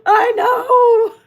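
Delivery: loud laughing shout; cracking up for real; laughing hard. Real, not acted. Laughing loudly with viewers.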